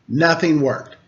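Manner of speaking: usual speaking voice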